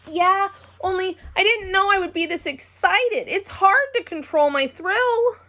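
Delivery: high, still too loud and thrilled; can't hold back the thrill; excited. Acted. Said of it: "it kept on staying loud".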